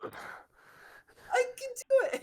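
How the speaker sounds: high, squeaky. Retold or imitated. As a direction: squeak it out cheerily. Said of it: "I would".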